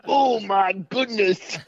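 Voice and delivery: high, slow drawn-out gasp; milk the shock, stretch it out; mock astonishment. Exaggerated exclamation.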